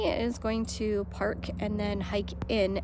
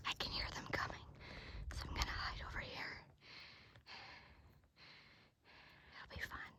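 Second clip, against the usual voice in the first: whispering while hiding; 17 dB quieter, faster than usual. Real, not acted. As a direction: whisper so they won't hear. Hiding to surprise family, whispering.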